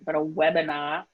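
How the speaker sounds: webinar in her own thick Boston accent. Real, not acted. Apologizing on a webinar for her Boston accent, broad Boston accent. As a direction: let the Boston vowels through on webinar, a bit sheepish. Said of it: "I'm gonna say this in a horrible Boston accent".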